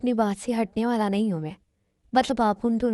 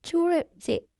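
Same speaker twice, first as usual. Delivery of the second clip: higher, tearful "let go of me"; crying plea; 7 semitones higher than usual. Acted. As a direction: pull away while crying. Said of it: "रो क्यों रही हो?".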